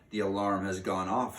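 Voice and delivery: plain reading voice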